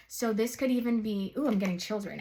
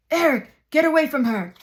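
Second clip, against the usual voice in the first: loud, higher villainous witch outburst; Ursula's sea witch voice; 6 semitones higher, 12 dB louder than usual. Retold or imitated. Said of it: "it was her sea witch voice".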